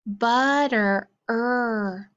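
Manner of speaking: "butter" then its ending "er" alone, drawn out; sound card drill. Shown as a demonstration. Teacher drilling sound cards with the class, ending held. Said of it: "get ready for our sound cards".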